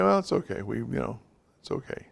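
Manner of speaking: soft, gentle reassuring words fading to a near whisper; hushed and kind, letting the mistake go; calm reassurance. Shown as a demonstration. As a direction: soothe her quietly, no blame. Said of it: "you have to say".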